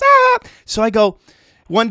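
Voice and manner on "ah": much higher and much louder, manic squeal; John the wired boss. Retold or imitated. Coked-up landscaping boss greeting the twelve-year-old, shrieking high and loud. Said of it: "And he goes".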